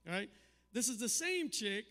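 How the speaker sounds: ordinary delivery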